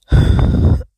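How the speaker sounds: hard voiceless cough; harsh cough. Real, not acted. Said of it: "let me catch my breath".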